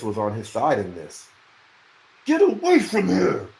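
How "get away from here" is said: high, loud order; shouting. A narrator's reading. Dunk shouting the diggers away, high and loud. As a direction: drive them off with a shout. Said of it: "he shouted at the diggers".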